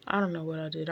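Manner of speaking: normal talking voice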